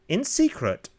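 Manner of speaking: plain reading voice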